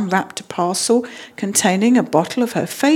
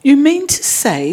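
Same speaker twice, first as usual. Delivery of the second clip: higher-pitched spluttered disbelief; spluttering; 7 semitones higher, 4 dB louder than usual. A narrator's reading. Mister Brown to Paddington, found in the other room, spluttering. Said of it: "spluttered Mister Brown".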